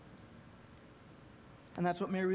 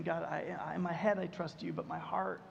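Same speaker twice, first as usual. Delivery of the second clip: quiet confession; 6 semitones lower, 8 dB quieter than usual. Retold or imitated. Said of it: "you're saying".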